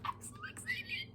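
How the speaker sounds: breathy high whisper; thrilled hushed whisper; excited whisper. Real, not acted. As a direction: whisper it, bursting with glee. Whispered after gasp.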